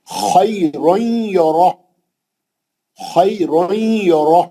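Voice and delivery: خیرن یرہ drawn out, ending ہ stopped; tajweed stop; slow recitation. Shown as a demonstration. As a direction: cut the final ہ of یرہ short. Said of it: "ہا کو ساکن کر کے روک دیں".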